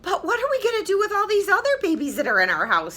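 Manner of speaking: high, fast, fretting father; worried father. Acted. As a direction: rush out the worry like a flustered dad. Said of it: "said Kristen's father".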